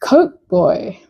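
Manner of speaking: usual speaking voice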